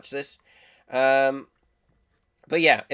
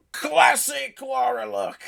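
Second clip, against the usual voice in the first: much higher, slow delighted shout; excited shout; 12 semitones higher, 4 dB louder, slower than usual. Real, not acted. To his viewers, thrilled by Lara's classic look, slow shout. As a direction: cry it out in fan joy. Gushing over Lara's new design.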